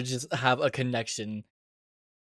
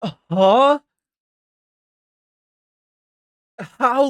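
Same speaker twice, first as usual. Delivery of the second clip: shocked disbelief; 8 semitones higher, 5 dB louder than usual. Real, not acted. Shocked reaction to survival news.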